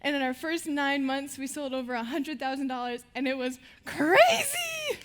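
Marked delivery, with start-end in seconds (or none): 3.8-5.0 high, loud, stretched-out cry of amazement; excited shout. Real, not acted. Telling the audience about the sales, excited loud shout. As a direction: belt it out, thrilled by the numbers.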